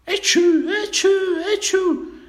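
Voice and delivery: tre starnuti acuti e forti; starnuto. A narrator's reading. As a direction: starnutisci forte, tre volte. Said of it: "però, sternutì". (starnuti acuti e sonori)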